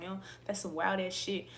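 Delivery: usual speaking voice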